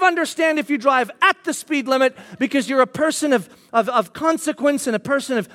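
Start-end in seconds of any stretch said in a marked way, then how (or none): none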